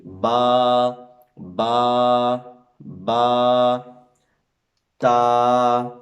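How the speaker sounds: put-on teacher projecting letters for children to copy; full-voiced letters. Shown as a demonstration. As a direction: call out با, تا, سا fully with open mouth. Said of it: "آواز پوری باہر نکالیں".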